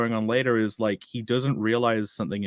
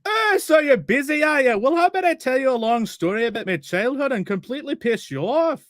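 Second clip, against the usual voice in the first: mocking the chatty caravan man; 13 semitones higher, 4 dB louder than usual.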